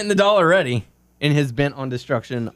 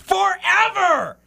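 high, slow mock scream; retold scream; much slower than usual. Listener reading a fan's note, mock screamed. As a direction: stretch out a mock yell. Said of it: "when Daniel screamed".

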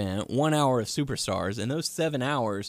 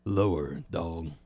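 lower-pitched, softer wrestler impression; Booker T; 7 semitones lower, 3 dB quieter, slower than usual. Shown as a demonstration. Co-host correcting a friend about his impression, low impersonation. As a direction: drop the pitch right down for the impression. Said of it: "Booker T's a little more".

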